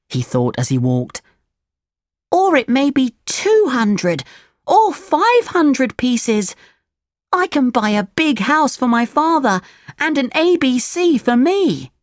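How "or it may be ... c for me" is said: put-on high puppet-boy voice; high storybook Pinocchio, thrilled by riches; excited Pinocchio. A narrator's reading. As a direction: daydream aloud, pitched way up.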